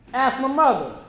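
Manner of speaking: normal talking voice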